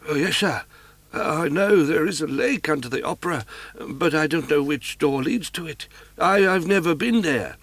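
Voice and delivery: a bewildered doorkeeper, anxious to help; high, fussy little concierge; flustered concierge. Acted. Concierge woman answers Raoul.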